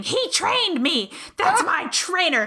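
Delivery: put-on high excited drunk fan yelling, half laughing; retold screaming fan. Retelling the drunk fan screaming in his ear, high mock shriek. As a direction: mimic the wasted fan shrieking about her trainer. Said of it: "she started screaming".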